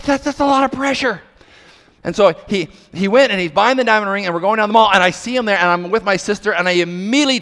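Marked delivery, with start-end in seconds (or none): 0.0-1.2 higher, alarmed protest; mock panic. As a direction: yelp it like you're overwhelmed. Panicked reaction to the plan.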